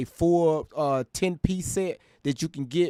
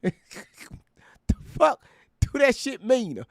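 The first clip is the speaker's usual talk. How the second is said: laughing disbelief; 8 semitones higher, much slower than usual. Real, not acted. Laughing disbelief after mockery.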